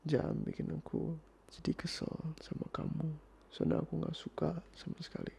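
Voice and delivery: hushed warning. Acted. Boyfriend warning partner not to push him, hushed and restrained. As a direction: keep it hushed, holding the annoyance back.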